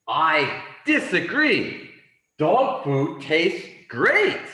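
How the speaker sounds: emphatic disagreement. Acted. Teacher voices the dog defending dog food, emphatic. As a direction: disagree firmly for a language class.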